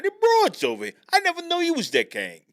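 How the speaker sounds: high, loud put-on character voice; exaggerated, high-pitched comic heckling; brash character voice. Co-host continues the character bit.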